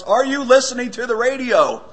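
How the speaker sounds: loud, high frustrated shout; exasperated holler, acted out; retold shout. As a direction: shout it as the fed-up husband. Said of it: "He finally shouts".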